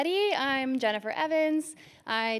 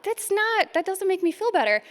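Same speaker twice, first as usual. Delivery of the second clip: high, quick mock disbelief; lightly played alarmed patient; incredulous; 8 semitones higher than usual. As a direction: retell it as if the doctor is crazy. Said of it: "I was like".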